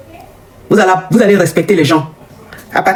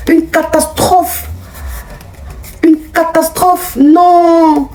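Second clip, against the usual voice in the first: dramatic lament; 8 semitones higher, much slower than usual. Real, not acted. Outraged lament about online insults.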